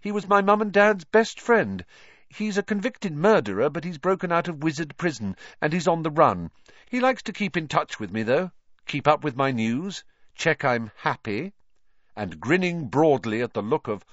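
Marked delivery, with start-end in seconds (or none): 0.0-11.5 cheerful Harry. A narrator's reading. Harry gleefully teasing Vernon.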